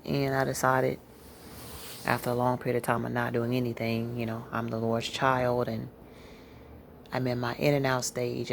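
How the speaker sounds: normal talking voice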